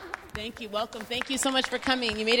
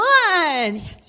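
loud, bright yes; excited yes; 4 semitones higher, 11 dB louder than usual. Real, not acted. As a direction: cheer the first volunteer. First volunteer raises hand.

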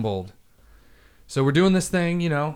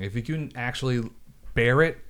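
usual speaking voice

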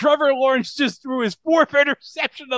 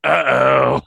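low, shouted uh-oh; loud uh-oh; 8 semitones lower, 4 dB louder than usual. Pretend. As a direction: bark the catchphrase. Echoing the catchphrase.

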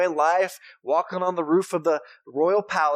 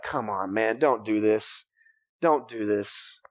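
exasperated plea; 8 semitones lower, slower than usual. Retold or imitated. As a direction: plead with a movie character you know will blow it. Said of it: "you're like".

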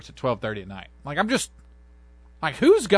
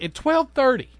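incredulous; 10 semitones higher, 3 dB louder, slower than usual. Retold or imitated. Outraged retort to fireworks.

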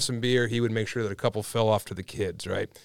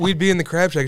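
ordinary talk, nothing marked

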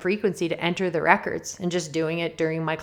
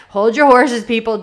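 playful call out; 4 semitones higher, 9 dB louder than usual. Real, not acted. Playfully reining in eager listeners.

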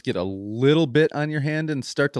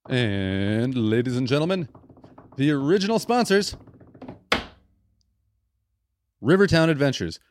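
announcer intro. Acted. Host announcing the original sponsors like an announcer. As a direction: present the sponsor like a ring announcer.

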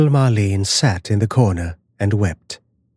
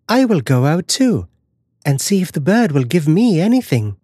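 put-on little girl's voice; 7 semitones higher than usual. Marlene voiced as girl.

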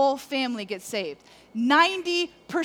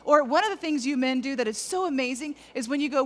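ordinary talk

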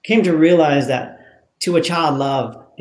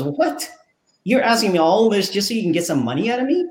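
high-pitched, incredulous outrage without raising the volume; mock indignation, rushed and pitched up; retold livid dad; 7 semitones higher, faster than usual. Storyteller voicing a dad fed up with his son, livid. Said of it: "And the dad gets livid".

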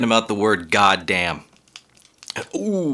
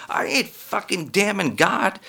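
ordinary talk

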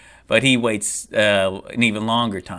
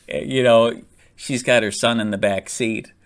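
usual speaking voice

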